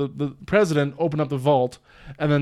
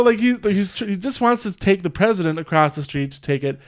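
usual speaking voice

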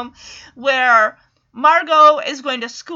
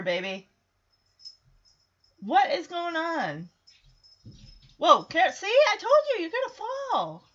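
scolding a pet; 5 semitones higher than usual. Real, not acted. Scolding her cat during recording.

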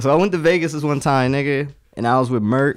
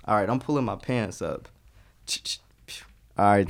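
normal talking voice

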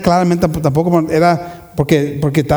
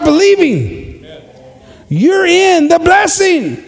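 full-force preaching; 9 semitones higher than usual. Real, not acted. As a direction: preach it out to the congregation.

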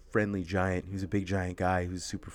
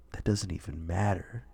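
softer, lower deadpan line; imitating Kyle; 5 semitones lower, 7 dB quieter than usual. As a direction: say it calm after the slam. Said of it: "was like".

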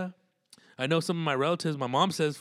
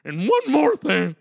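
much higher, loud, slow mock outburst; hammed-up airport rant, voice straining upward; mock red-faced fury; red-faced mad; 12 semitones higher, 10 dB louder than usual. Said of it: "they get all mad and red in the face".

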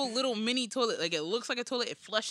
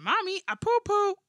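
higher, louder mock kid voice; small child announcing; 10 semitones higher, 7 dB louder than usual. Child tells mommy about an accident, high kid voice. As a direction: pipe up like a kid calling for mommy. Acts out his child arriving.

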